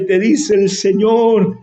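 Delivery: ordinary delivery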